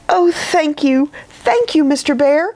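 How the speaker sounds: high, gushing thanks; grateful cry. A narrator's reading. Edith hugs Mister Bear for forgiving her, grateful cry. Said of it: "cried Edith".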